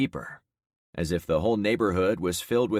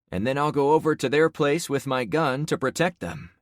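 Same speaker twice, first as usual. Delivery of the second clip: firm explaining; 7 semitones higher, 4 dB louder than usual. Acted. Protagonist explains role.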